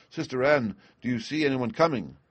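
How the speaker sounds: plain reading voice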